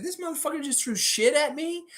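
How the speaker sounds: high, fuming mascot voice; angry raptor. Retold or imitated. Enraged Raptor mascot after getting hit with mud, high fuming voice. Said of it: "He's like".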